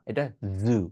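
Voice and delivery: জু with a buzzing z, not j; voiced z demo. Shown as a demonstration. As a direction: say জু with the z vibrating. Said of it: "এটা জু না". (জ-এর বদলে জেড ধ্বনি দিয়ে জু)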